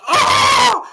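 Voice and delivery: laughing mock pain yelp; cramp cry of pain. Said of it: "I'm like".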